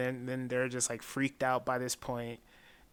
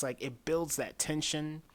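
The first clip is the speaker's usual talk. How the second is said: ordinary talk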